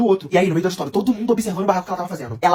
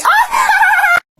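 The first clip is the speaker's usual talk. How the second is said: high shrieking cackle, much louder; wild laugh; 5 dB louder than usual. Real, not acted. Vlogger laughing at his own prank, shrieking. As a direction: burst into a screaming laugh.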